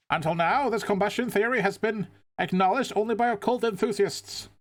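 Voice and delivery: high, eager TV reporter; news reporter voice. Acted. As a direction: play the pushy live reporter. Reporter voice continues.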